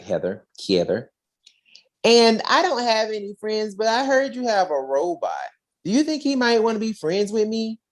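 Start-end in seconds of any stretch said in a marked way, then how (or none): 2.0-7.8 put-on light high little-girl voice. Little girl asking the ranger about his robot, in a high sweet voice.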